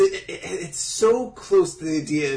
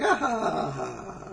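mock laugh; 3 semitones higher, 5 dB quieter than usual. Imitating a character's laugh.

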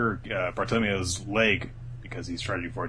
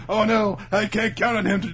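high, loud mock dismay; 12 semitones higher, 5 dB louder than usual. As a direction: wail it in hammed-up alarm. Acting Bartolomeo's dismay.

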